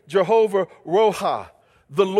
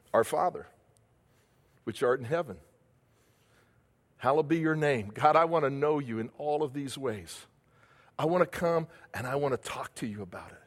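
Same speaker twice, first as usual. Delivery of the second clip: much softer, lower prayer voice; quiet prayer; 5 semitones lower, 6 dB quieter than usual. Acted. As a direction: pray it hushed and close.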